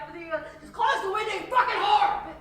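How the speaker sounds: high, very loud yell building to the insult; acted rage, shouted at full force; furious outburst. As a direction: blow up and scream it at them. Acted quarrel over window, blowing up.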